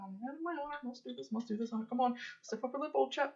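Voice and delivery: soft muttered self-pep-talk; mumbling to himself. Acted. Character walking past companions, mumbling to himself. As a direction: mumble it under your breath as you go. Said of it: "he's, like, mumbling that".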